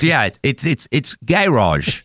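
"garage" drawn out as GA-rahzh, imitated New Zealand accent; [name]. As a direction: slowly say "garage" with a full first vowel, GA-rahzh. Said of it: "your impersonation's pretty good".

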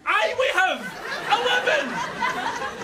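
high, drawn-out cry of protest; exasperated retort. Acted. Trapped man answering back to the lift, exasperated.

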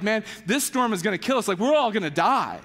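normal talking voice

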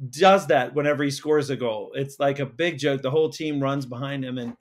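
ordinary talk

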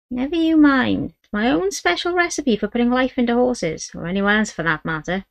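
put-on the old healer keeps her recipe secret. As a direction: voice the old Romany woman, cagey. Great-grandmother voiced.

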